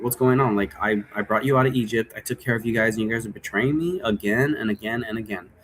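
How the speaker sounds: normal talking voice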